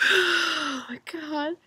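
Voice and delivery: slow loud drawn-out gasp; held breath before a sneeze; about to sneeze. Real, not acted. Sneeze building up.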